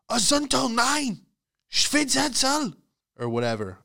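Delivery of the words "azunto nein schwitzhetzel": high, strained fake-German orders; mock German commands. Handler commanding a German Shepherd, high mock-German shouts. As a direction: bark gibberish like a German dog handler. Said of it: "only respond to German commands".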